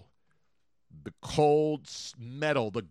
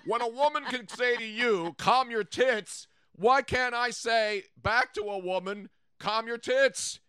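excited; 8 semitones higher than usual. Real, not acted. Animated rant about saying.

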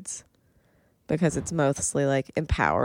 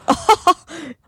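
high loud guffaw laugh; 12 semitones higher, 4 dB louder than usual. Shown as a demonstration. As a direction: demo a big guffaw. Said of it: "guffaw is kind of like".

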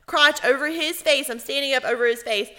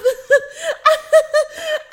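loud, high burst of laughter; 6 dB louder than usual. Real, not acted. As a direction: shriek with laughter. Laughing at own story.

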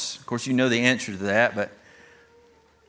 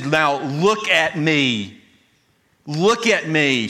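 "look" stressed and stretched slowly, both times; emphatic plea; 3 semitones higher, slower than usual. Retold or imitated. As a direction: lean hard on "look", slow and deliberate. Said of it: "it's very emphatic".

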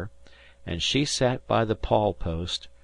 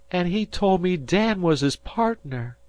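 much higher joyful exclamation; mother's cry; 10 semitones higher than usual. A narrator's reading. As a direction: burst out with a mother's joy. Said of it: "she cried".

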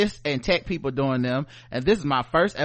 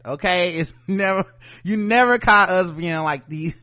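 usual speaking voice

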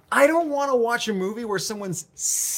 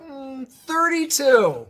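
slow mock guess; 6 semitones higher, 5 dB louder, much slower than usual. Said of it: "be like".

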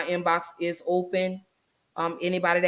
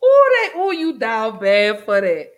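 excited teasing; 3 semitones higher, 7 dB louder than usual. Real, not acted. Host teasing her co-host about his reaction, loud and playful. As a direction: tease him, delighted and scandalised.

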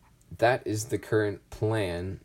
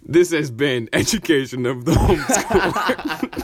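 loud, high-pitched show sign-off; over-the-top announcer outro; 6 semitones higher, 9 dB louder than usual. Shown as a demonstration. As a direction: belt out the closing title like an announcer. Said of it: "I can do the announcer voice".

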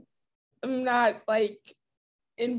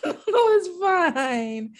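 slow, laughing, slightly raised pitch; laughing reassurance; 4 semitones higher, much slower than usual. Real, not acted. Host excusing the player's apology, laughing reassurance. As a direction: brush off the apology through real laughter.

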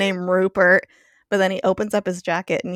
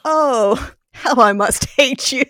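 raised, louder voice breaking into laughter; mock outburst; 5 semitones higher, 7 dB louder, slower than usual. Spike to his supposed dad, in a mock outburst. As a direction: declare it with hammed-up loathing, half laughing.

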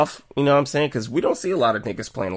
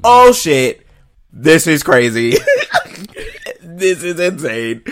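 loud, high, laughing disbelief; amazed; 6 semitones higher, 8 dB louder, much slower than usual. Retold or imitated. Viewer retelling the first shocking scene, amazed. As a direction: stretch it out, cracking up in amazement.

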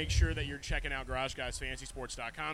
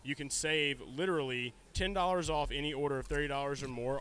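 ordinary talk

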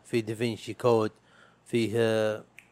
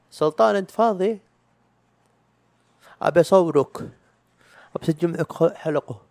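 higher, louder call to his brother; 6 semitones higher, 5 dB louder than usual. Retold or imitated. Asking brother Sultan to record an episode, calling out. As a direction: shout across the house to Sultan.

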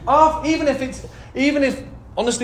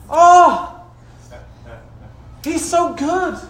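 high, loud preacher's exhortation; impassioned, drawing out the praise; fervent preaching; 9 semitones higher, 4 dB louder than usual. Real, not acted. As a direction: rouse the congregation with raised voice. Preaches at full force.

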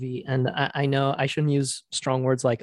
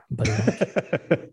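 slow, mock-smooth host intro; buttery voice; 2 semitones lower, slower than usual. Host introducing what we binge, playful buttery voice. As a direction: ooze through the show's intro like butter. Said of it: "My buttery voice".

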